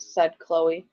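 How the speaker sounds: ordinary talk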